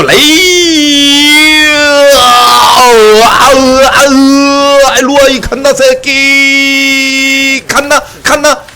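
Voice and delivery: high, loud, drawn-out roaring yell; battle yell. Real, not acted. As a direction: roar at the enemy as you dive in. Yelling during fight.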